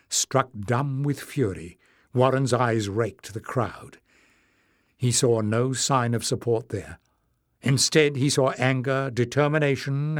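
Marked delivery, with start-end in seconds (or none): none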